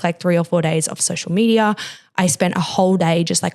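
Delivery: ordinary talk, nothing marked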